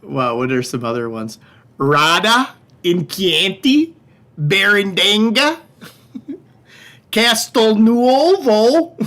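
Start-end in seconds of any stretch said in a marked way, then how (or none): 1.8-9.0 put-on slow, higher Wisconsin accent on Italian names. Viewer request, Italian regions in slow hammy Wisconsin accent. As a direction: stretch each town in a goofy Wisconsin drawl. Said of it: "with a Wisconsin accent".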